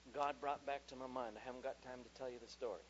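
normal talking voice

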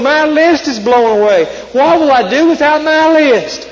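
high, anxious storybook whine; fretful Toad. A narrator's reading. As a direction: Toad beside himself over his lost list. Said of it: "cried Toad".

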